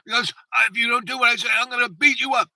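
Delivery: retold angry dad, high and loud; worked-up colonel losing his patience; screaming threat. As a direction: imitate a father yelling a threat. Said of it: "he started just screaming orders".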